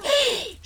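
sharp startled gasp. Acted. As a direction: catch the breath in alarm. Crow startled by the secrecy.

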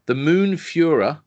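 mock-epic synopsis read, high and slow; dramatic reading. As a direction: draw out the villain's title grandly. Reads out film summary with drama.

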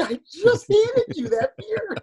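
high, slow, disbelieving complaint; incredulous. Retold or imitated. Man tells friend who tossed a fresh can, high and slow in disbelief. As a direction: stretch it out in disbelief.

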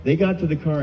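normal talking voice

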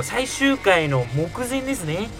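ordinary talk

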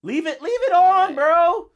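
yelling. Real, not acted. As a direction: yell it across the room. Yelling as phone is grabbed.

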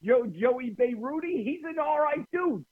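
very high-pitched comic vouching; high mock-earnest endorsement; put-on character voice. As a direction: pitch it way up, playfully hammed-up. Acted character vouching for Joey.

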